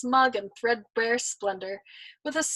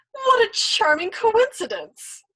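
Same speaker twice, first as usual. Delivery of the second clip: delighted; 12 semitones higher, 7 dB louder, slower than usual. Acted. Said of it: "with delight".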